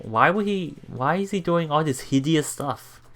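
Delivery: ordinary delivery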